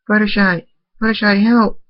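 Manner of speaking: high, loud desperate scream of a name; screaming for help. Acted. Waking to a friend choking, screaming for Fluttershy. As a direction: yell for help with everything you have. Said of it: "I screamed".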